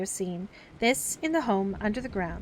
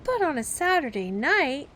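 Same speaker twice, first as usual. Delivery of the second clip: sly, high coaxing hint; insinuating; 6 semitones higher, 5 dB louder than usual. Acted. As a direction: slip the hint in slyly. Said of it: "Slightly insinuated".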